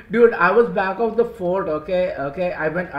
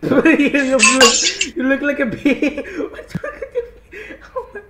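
laughing; 9 semitones higher, 5 dB louder, slower than usual. Real, not acted. Laughing at the avatar.

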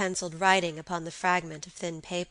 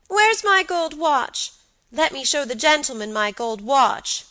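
put-on high, loud little boy's demand; child's cry; 7 semitones higher, 8 dB louder than usual. A narrator's reading. Boy wants to show off his watch, crying out high. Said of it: "cried Georgie".

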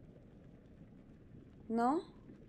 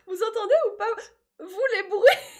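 high, loud, squealed question; worked-up squeal; 9 semitones higher, 10 dB louder than usual. Real, not acted. Jumpy streamer asks viewers about the noises, high squeal. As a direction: squeal it out, far above normal pitch.

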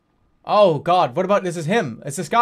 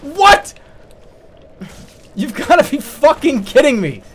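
loud, higher, indignant ranting; outraged disbelief; 5 semitones higher, 4 dB louder than usual. Real, not acted. Gamer raging at a missed hit, loud disbelief. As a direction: rant at the game's injustice.

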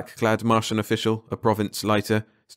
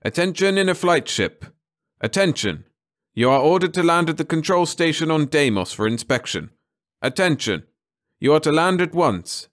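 loud, high announcement over a ship's speaker; metallic loudspeaker rasp; 8 semitones higher, 4 dB louder than usual. Acted. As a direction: call it out like a harsh PA system. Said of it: "The metallic rasp of the speaker".

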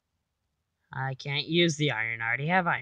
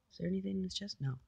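usual speaking voice

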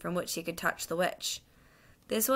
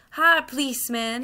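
higher, louder cabman's call; hailing; 6 semitones higher, 6 dB louder than usual. Acted. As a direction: hail the policeman across a crowd. Cabman hails the policeman.

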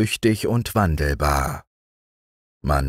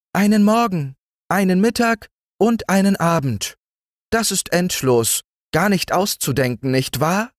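marvelling mayfly; 12 semitones higher than usual. A narrator's reading. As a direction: marvel at a whole day as the mayfly.

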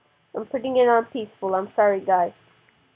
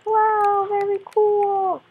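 mock awed; 10 semitones higher, much slower than usual. Mock awed reaction to pickaxe.

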